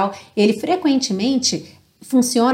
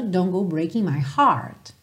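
lower, softer American-style line; American accent; 3 semitones lower, 4 dB quieter than usual. Shown as a demonstration. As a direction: sing "heart" with an American R. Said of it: "com sotaque mais americano".

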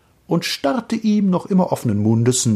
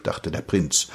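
plain reading voice

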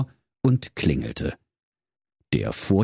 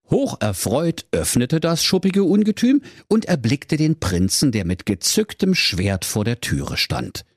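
ordinary delivery, nothing marked

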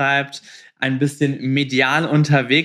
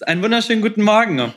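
bright, fast, higher greeting; lively greeting; 6 semitones higher, much faster than usual. Acted. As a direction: welcome her in with a burst of energy. Host greets guest energetically.

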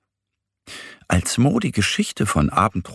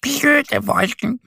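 much higher, slow, sulky duck grumble; grumbling; slower than usual. A narrator's reading. Sad Annie scolds the clouds to Mo, mild grumble. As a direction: scold the clouds like a cross duckling. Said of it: "doch sie schimpft nur".

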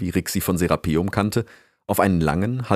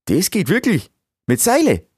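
high, loud incredulous questions; amazed; 12 semitones higher, 6 dB louder than usual. Acted. Beaver hears ropes can make fire, amazed. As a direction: voice leaps up in disbelief.

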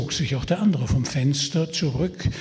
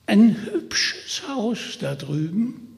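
put-on old man voice; 6 semitones higher, slower than usual.

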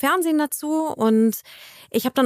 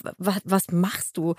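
slow, lower stammer of disbelief; stunned disbelief; 4 semitones lower, 4 dB quieter, slower than usual. Retold or imitated. Brother to sister giving directions, stunned disbelief. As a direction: imitate a dumbfounded brother, stumbling on the first word. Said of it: "Mein Bruder stand fassungslos neben mir".

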